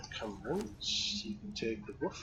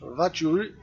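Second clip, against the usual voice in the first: put-on German accent; 4 semitones higher, 10 dB louder than usual. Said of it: "that was German".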